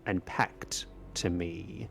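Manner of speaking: slow, weighty kingly pronouncement; regal spectral king, solemn. Acted. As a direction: hold them to an oath, gravely and deliberately.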